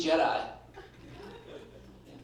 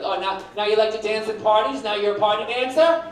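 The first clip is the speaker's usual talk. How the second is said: mock jealous wife; 6 semitones higher, 7 dB louder than usual.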